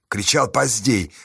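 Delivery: usual speaking voice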